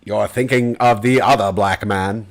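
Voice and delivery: slow, measured actor impression; Morgan Freeman. Actor answering a mistaken fan, slow impression. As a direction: take your time like a stately narrator. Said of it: "That's my Morgan Freeman impression".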